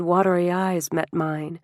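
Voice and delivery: ordinary delivery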